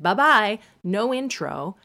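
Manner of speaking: bright, loud, careless send-off; breezy mock goodbye. Parents dropping a three-year-old off at school, breezy. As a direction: toss off a cheery goodbye. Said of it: "They were like".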